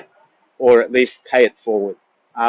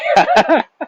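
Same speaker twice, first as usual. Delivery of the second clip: tearful laugh; 9 semitones higher, 3 dB louder than usual. Real, not acted. Tearful laugh after crying remark.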